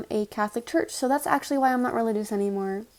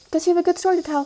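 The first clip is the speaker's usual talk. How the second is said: higher, louder mocking echo; mocking quote; 7 semitones higher, 6 dB louder, much faster than usual. Storyteller mimics the unhelpful neighbour, mocking and raised. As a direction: throw the words back in a raised, incredulous imitation.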